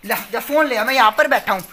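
ordinary talk, nothing marked

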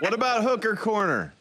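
high-pitched hammed-up Southern accent; bad Southern accent. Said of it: "I hear somebody doing a bad Southern accent".

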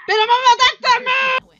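high, very loud protest; outraged shout. Real, not acted. Killed player protesting to the killer, outraged shout.

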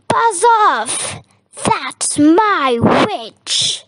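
yowling, growling monster, starting high and slowing down; terrible beast voice. A narrator's reading. Beast orders the dragon away from its witch, yowling and growling. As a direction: warn off a rival as a horrible beast. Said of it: "was a yowl and a growl and a croak".

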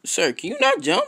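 very high, sarcastic squeak of a question; sarcastic high-pitched politeness; mock-polite. Real, not acted. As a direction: ask it like an exasperated clerk. Mock-polite taunt at character.

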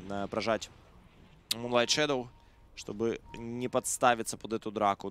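ordinary talk, nothing marked

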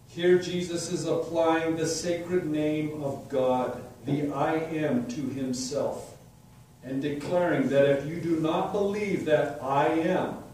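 ordinary talk, nothing marked

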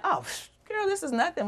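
high, light brush-off; dismissive. Retold or imitated. Brother telling newfound sister it's nothing, breezy dismissal. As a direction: wave it away in a high, casual voice.

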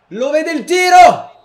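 building to a high, shouted shot call; breathless italian goal commentary. Acted. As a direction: rise to a full commentator yell. (telecronaca che esplode sul tiro)

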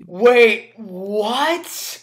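sharp loud disbelieving "wait"; surprised. Real, not acted. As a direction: stop the video in shock. Reacting to a shocking claim.

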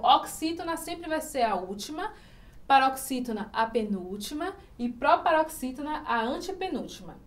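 ordinary delivery

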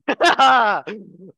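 sudden loud, high burst of laughter. Real, not acted. Host laughing too loud for everybody's earphones, loud burst of laughter. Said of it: "that was loud".

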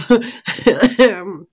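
bright burst of laughter; short amused laugh. Real, not acted. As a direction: laugh out loud at herself. Laughs after applying lipstick.

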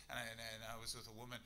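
ordinary talk

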